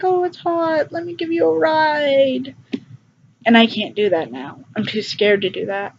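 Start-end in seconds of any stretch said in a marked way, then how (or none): 0.0-2.6 high, light mock-cheerful offer; breezy helpful self. To a stranger by the road, a high breezy offer. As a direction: lightly play your carefree former self. Mimics her old friendly self.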